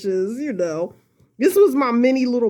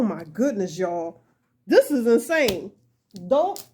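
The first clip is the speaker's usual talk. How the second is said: ordinary talk, nothing marked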